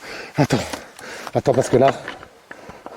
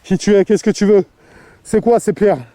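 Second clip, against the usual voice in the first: loud, higher, challenging the dark; calling out; 5 semitones higher, 7 dB louder than usual. Real, not acted. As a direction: call out to the spirit. Calling out to a spirit.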